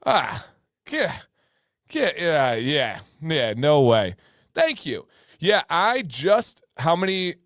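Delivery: ordinary delivery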